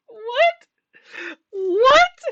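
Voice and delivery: very high, squeaky, loud disbelief; incredulous squeal. Real, not acted. Fan reacting to the two weeks claim, incredulous squeal.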